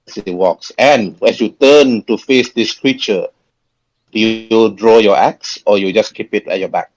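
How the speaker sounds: normal talking voice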